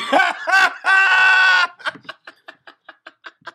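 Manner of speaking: loud, high burst of laughter; long cackle, well above the speaking voice; big laugh. Real, not acted. Co-host cracks up at the Good Scout joke, big laugh.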